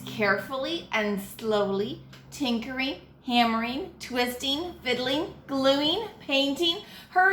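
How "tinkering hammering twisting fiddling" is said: ordinary delivery, nothing marked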